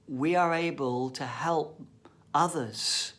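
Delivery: normal talking voice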